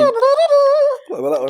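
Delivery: saxophone impression with thumb in mouth; sucking thumb. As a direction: play it with a thumb in your mouth. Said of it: "do you have your thumb in your mouth?".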